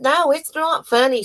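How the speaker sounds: high, firm no-nonsense rebuke; retold stern rebuke. Kid laughs at hurting the dog, retold high stern rebuke.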